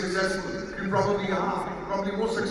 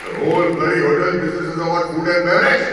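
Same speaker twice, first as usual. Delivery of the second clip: a booming, scolding manager voice, much louder; 7 dB louder, faster than usual. Retold or imitated. Manager bellows at the job applicant, a loud scold. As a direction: bellow it like a boss telling off a rookie.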